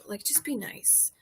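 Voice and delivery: soft, breathy under-the-breath plea; hushed, fed-up aside; whispered aside. Real, not acted. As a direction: say it under your breath, a little exasperated.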